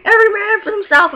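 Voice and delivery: high, loud shout; battle cry. Retold or imitated. Gunman opening fire in dream, shouted battle cry. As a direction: holler it as a rallying cry.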